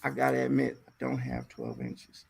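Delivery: low, soft, embarrassed admission; sheepish confession. Retold or imitated. Man confessing to her, low and soft. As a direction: drop the voice in shame. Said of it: "his confession".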